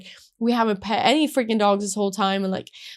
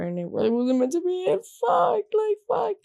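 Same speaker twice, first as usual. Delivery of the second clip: retold despair climbing to very high pitch; upset, building; 10 semitones higher than usual. Woman retelling failed proposal hopes, rising upset whine. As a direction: start near normal and rise into a high mock wail. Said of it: "I was just so upset".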